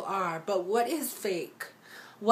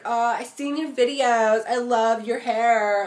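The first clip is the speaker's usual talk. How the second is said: louder, gushing fake flattery, last words slowed; mock fake compliment; 2 semitones higher, 8 dB louder than usual. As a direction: overdo a sugary two-faced compliment. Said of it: "smile to my face and be like".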